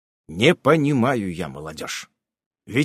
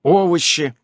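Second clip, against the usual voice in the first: ordinary delivery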